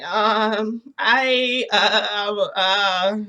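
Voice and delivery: loud, drawn-out flustered stammering; flustered hemming and hawing; lost for words. Retold or imitated. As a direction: stall on each filler, caught out. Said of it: "I'm, like, lost for words".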